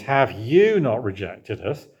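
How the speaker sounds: "you" leaned on, slow; slow, with the weight on "you"; stress on YOU. Shown as a demonstration. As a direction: press hard on "you" in "Have you not rejected us". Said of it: "the emphasis is on you again".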